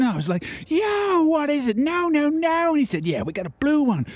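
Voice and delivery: put-on high, shouty hype-man American accent, drifting as it goes; over-the-top American accent. Telling friends an epic barbecue-buying story, over-the-top American accent. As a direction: yell it in a cartoonish South Park-style American accent, stretching each yo. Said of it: "the accent changes as it goes".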